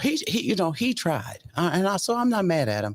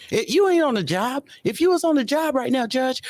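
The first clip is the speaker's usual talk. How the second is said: scolding judge; 7 semitones higher than usual. Retold or imitated. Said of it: "You know".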